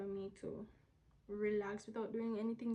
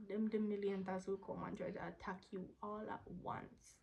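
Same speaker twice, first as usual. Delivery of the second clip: ordinary delivery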